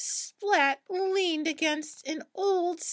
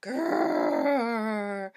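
deep, long monster growl; low growl; 5 semitones lower than usual. Acted. Unseen creature growls at the cats, low growl. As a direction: growl from the shadows. Spooky sound effect.

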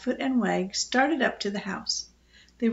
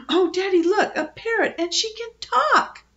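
put-on high, excited little girl; amazed child; 10 semitones higher, 4 dB louder than usual. A narrator's reading. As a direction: marvel loudly in a high voice. Girl amazed by talking parrot.

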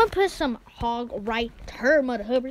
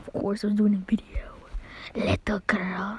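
breathy aside; annoyed whisper about the sister; muttered whisper; 3 semitones lower, 7 dB quieter than usual. Real, not acted. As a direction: whisper it to yourself dismissively.

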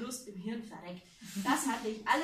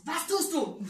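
higher, louder alarmed question; 5 semitones higher, 7 dB louder, slower than usual. Acted. Man panics as his wife moans on the phone. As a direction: demand it in panic. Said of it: "fragte ich".

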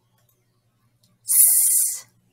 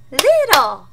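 louder, higher "Little."; loud echo; 3 semitones higher, 8 dB louder than usual. Shown as a demonstration. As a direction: call the word back. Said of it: "You will repeat".

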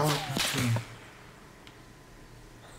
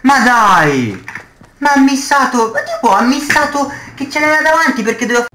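shouting in disbelief, high and loud; explosive italian cursing; 11 semitones higher, 6 dB louder, faster than usual. Real, not acted. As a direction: yell it furious at the miss. Furious at teammate's missed shot.

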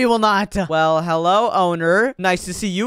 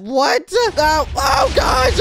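high panicked yelling; panicked shout; 10 semitones higher, 4 dB louder, much slower than usual. Acted. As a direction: yell as the machine stings. Painful transformation in the chamber.

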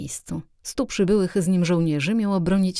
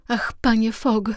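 put-on higher, drawn-out cry of the young woman; emotional outcry; 5 semitones higher than usual. A narrator's reading. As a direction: exclaim it slowly, moved to tears. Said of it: "zawołała młoda kobieta".

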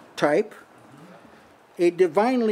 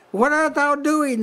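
much higher, airy angel asking Hagar; angel's voice; 8 semitones higher than usual. Acted. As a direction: lift way up as the angel calling Hagar. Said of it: "this is an angel talking".

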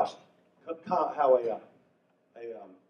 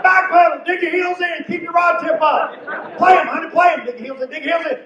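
high excited shouts; frantic husband shouting to wife; yelling coaching; 12 semitones higher, 10 dB louder than usual. Retold or imitated. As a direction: holler instructions across the pond. Husband yelling fishing advice.

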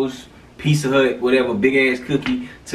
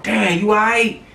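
mock concern; 8 semitones higher than usual. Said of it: "I'm like".

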